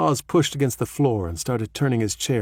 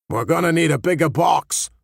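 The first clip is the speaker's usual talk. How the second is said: clumsy, hammed-up New England accent; a weak stab at a New England accent; bad New England accent; 6 semitones higher, 4 dB louder than usual. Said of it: "in his best New England accent, which wasn't very good".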